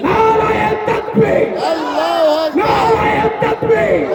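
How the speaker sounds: chanting crowd-rousing sermon shout; fiery preaching. Acted. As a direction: rouse the crowd from the stage. Fiery sermon against insulters.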